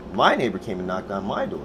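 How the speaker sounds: ordinary talk, nothing marked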